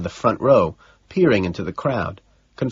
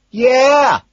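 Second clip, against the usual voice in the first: high, long yell; one full-volume shout; shouting back; 8 dB louder than usual. A narrator's reading. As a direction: holler in agreement. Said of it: "he shouts back".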